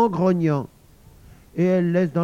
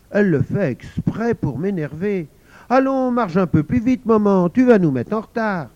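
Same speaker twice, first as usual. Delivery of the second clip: higher and louder, urging; impatient child; 5 semitones higher, 5 dB louder than usual. Acted. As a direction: hurry mum along. (petite fille agacée qui presse)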